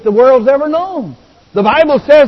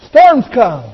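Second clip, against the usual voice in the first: usual speaking voice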